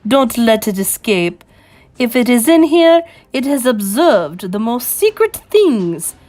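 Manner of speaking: imperious witch ordering an extermination; screeching Grand High Witch. Acted. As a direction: command the kill, stressing each word. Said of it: "screeched the Grand High Witch".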